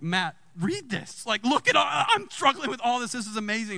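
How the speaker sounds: high-pitched retold amazement; eager, amazed reenactment; acted excitement. Reenacts urging Matt to read.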